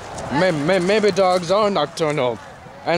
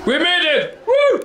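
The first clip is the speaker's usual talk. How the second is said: excited cheer; 12 semitones higher than usual. Real, not acted. Reaching the maze exit.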